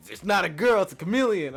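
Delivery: high, very loud protest; distraught correction. Retold or imitated. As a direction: yell it as the distraught friend. Distraught friend corrects him.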